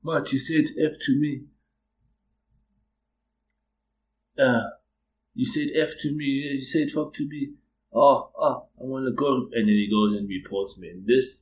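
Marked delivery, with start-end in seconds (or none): 0.0-9.5 higher-pitched mock accusation; slightly put-on, high complaining voice; lightly mimicked accuser. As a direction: imitate an aggrieved colleague, pitched up. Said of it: "this guy walks up like".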